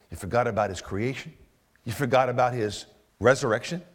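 ordinary delivery

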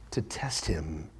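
dropped to a whisper; whispered, pulled in close; hushed. Acted. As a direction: breathe the words out low. Preacher drops voice on scripture.

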